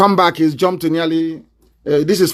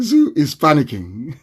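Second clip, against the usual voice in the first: ordinary talk, nothing marked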